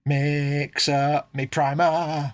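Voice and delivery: very slow, stretched-out words; playfully elongated, held vowels; drawn-out echo. Pretend. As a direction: draw every word right out. Drawn-out echo of previous phrase.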